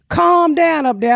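hammed-up slow scold; mock stern man. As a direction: mimic a man calling up to scold her. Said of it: "this man was like".

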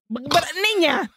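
high-pitched exasperated mock scolding. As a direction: scold her in playful disbelief. Teller mock-scolds the character.

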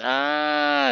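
high held mock scream; sustained high "aah" at ordinary volume; imitated scream. Narrator imitates Reddick fleeing, mock scream.

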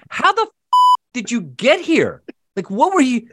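as if you can't believe he's here; incredulous. Retold or imitated. Owner asks lost dog how he got here, incredulous.

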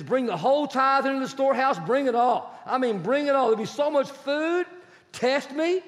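high, loud scripture proclamation; fervent preaching. Acted. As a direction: preach it out to the congregation. Preaching Malachi at full force.

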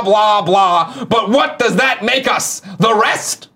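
booming wrestling promo, slow and high; loud WWE voice. Acted. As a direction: bellow it like a WWE heel. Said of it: "loud W W E voice".

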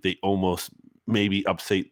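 ordinary talk

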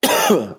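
sudden high, hacking cough; choking on a cough; coughing fit. Real, not acted. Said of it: "Sorry, I'm choking here".

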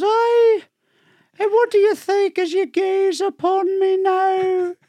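high, slow Scottish woman's voice; sultry high Scottish wife. Retold or imitated. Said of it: "told in a very Scottish accent".

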